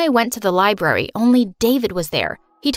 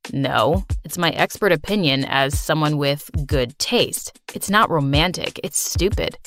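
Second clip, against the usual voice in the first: put-on sarcastic teen boy; 6 semitones lower than usual. [name] argues with Claire.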